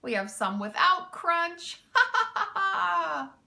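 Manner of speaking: can't stop laughing. A narrator's reading. Mac taunts the thieves with the cargo, laughing. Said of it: "Mac continued laughing".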